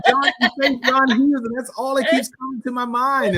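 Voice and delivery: high giggly embarrassed talk; flustered laughing confession. Real, not acted. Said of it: "I'm so crazy".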